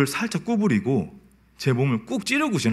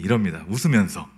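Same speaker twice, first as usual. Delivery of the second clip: usual speaking voice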